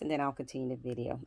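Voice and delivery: ordinary delivery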